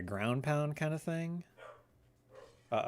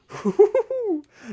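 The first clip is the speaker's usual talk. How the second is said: high, loud bouncy hooting laugh; cartoon tiger's hoo-hoo-hoo, pitched way up; Tigger; 10 dB louder than usual. Gamer answers an impression request, high whooping cartoon laugh. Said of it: "Can you do a Tigger impression?".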